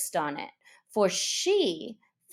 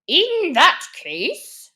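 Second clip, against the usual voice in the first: loud, slow, solemn bird voice; solemn Dodo; 7 semitones higher, 7 dB louder, slower than usual. Acted. Dodo solemnly addressing the meeting, slow. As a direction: rise and pronounce it gravely. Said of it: "said the Dodo solemnly".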